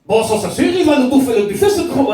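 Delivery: high, loud Belgian boss; mock Belgian accent. Belgian buffet boss grumbling about a big eater, mock Belgian accent. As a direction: complain as a Belgian restaurant boss. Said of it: "c'est un patron de, belge".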